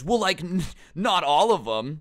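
high, sheepish mock admission; imitating a cheeky student caught out; smiling kid. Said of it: "he smiles and he goes".

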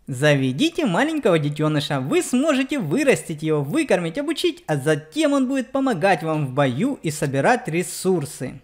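usual speaking voice

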